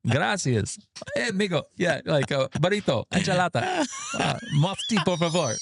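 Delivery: high, hammed-up tourist Spanish accent; mock Spanish accent. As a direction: order like an eager tourist showing off Spanish. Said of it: "I'm the guy that goes into the Mexican restaurant".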